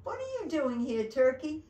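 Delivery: amused, loud surprise; surprised Santa. Acted. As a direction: exclaim at finding an unexpected visitor. Santa greets turkey in surprise.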